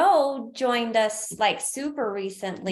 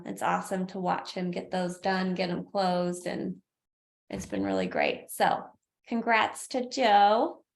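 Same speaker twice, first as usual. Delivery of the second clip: usual speaking voice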